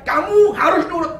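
loud, high domineering order; acting out a husband raising his voice. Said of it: "Kita kencengin suara kita".

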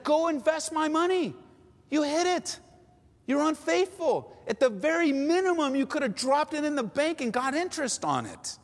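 high-pitched, forceful scolding of a servant; master's stern rebuke. Acted. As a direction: preach it as an indignant master's reprimand.

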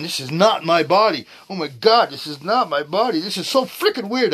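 loud, high, mock-panicked exclaiming; retold freak-out. Man reliving discovering his changed body, retold freak-out. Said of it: "freaking out, going".

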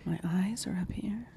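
soft, creepy whisper; whispered serial killer bit. Retold or imitated. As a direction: breathe it out like a killer showing off. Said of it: "I can do an impression".

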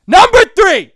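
high, loud announcing shout; fired-up yell. Real, not acted. Announcing his next pet peeve, fired-up shout. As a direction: bellow the number like a hype man. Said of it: "I'm getting fired up".

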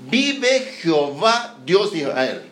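slow, weighty proclamation; solemn declaration. Acted. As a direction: pronounce it like an oath. Elías defies Acab.

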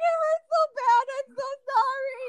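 high, loud wailing apology; mock hysterical crying. Patient apologizing to husband after procedure, mock hysterical crying. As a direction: imitate a tearful, hysterical wail. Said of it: "I was hysterical".

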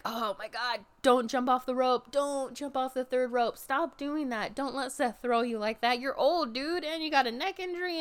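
high, urgent worried fan pleading; frantic pleading. Retold or imitated. Fan pleading with an old wrestler, high and frantic. Pleading with Edge during match.

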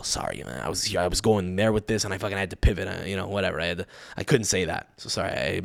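low, soft, sheepish explaining; subdued apology. Retold or imitated. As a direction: apologise privately, voice dropped. Acts out later apology to uncle.

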